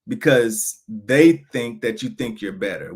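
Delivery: normal talking voice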